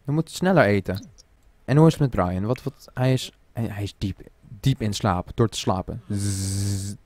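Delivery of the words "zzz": drawn-out buzzing "zzz"; low, steady pretend sleep buzz; imitated snoozing. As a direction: mimic cartoon sleep with a long buzz.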